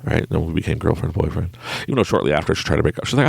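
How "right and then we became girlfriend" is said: usual speaking voice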